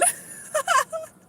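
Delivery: very high squealing laugh; a high shriek of a laugh. Real, not acted. Streamer cracking up at own joke, high squealing laugh. Laughing after shouting for friend.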